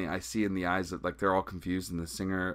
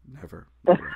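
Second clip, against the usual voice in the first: louder, rising sing-song "never, never"; emphatic refusal; 3 semitones higher, 8 dB louder than usual. Retold or imitated. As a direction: push the refusal up and out. Refusing the band's offer.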